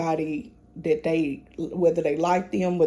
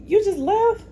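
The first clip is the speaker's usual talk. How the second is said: much higher, louder mock disbelief; mock grandma disbelief; 14 semitones higher, 5 dB louder than usual. Imitating grandma who just drove off, in mock high disbelief. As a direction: squeak it out in pretend shock.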